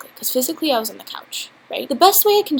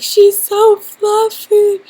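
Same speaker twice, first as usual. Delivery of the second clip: put-on much higher, louder, slow baby-talk squeal; 11 semitones higher, 11 dB louder, much slower than usual. Gushing over the fluffy dog, squealing baby voice. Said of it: "Like that".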